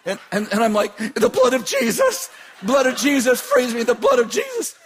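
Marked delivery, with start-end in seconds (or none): 1.1-4.8 high, loud repeated shouted plea; shouted rebuke. Retold or imitated. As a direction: cry out the rebuke over and over. Preacher battling demon, shouting.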